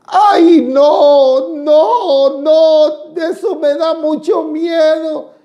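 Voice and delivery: high, loud panicked cry; scared shrill pleading; frightened woman. Retold or imitated. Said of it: "la mujer empezó a decir".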